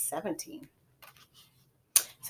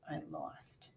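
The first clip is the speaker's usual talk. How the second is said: whisper; 18 dB quieter than usual. A narrator's reading. Boy admits defeat.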